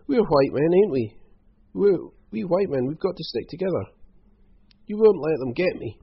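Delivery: high, loud, whining plea; wailing appeal. A narrator's reading. Croc appealing to Hal to stick together, wailing. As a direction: wail it, begging to be protected. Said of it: "wailed Rock".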